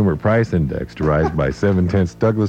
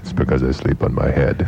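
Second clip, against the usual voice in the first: put-on low, droning German-accented statesman; deadpan accented impression, pitched down in a monotone; Henry Kissinger; 3 semitones lower than usual. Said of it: "the story with the accent".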